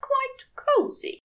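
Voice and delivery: high, fussy angel voice; prim high angel settling into bed; Aziraphale's voice. A narrator's reading. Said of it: "he said, closing his eyes".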